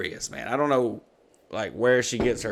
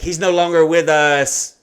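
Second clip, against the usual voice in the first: slow, high, drawn-out proclamation; loud announcement; 5 semitones higher, 10 dB louder, slower than usual. Retold or imitated. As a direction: imitate a kid solemnly broadcasting a death. Daughter announcing it by family's car.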